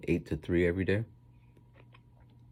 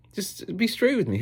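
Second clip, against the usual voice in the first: high pleading; 14 semitones higher, 3 dB louder than usual. Retold or imitated. Pleading to principal.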